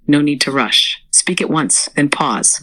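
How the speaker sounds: normal talking voice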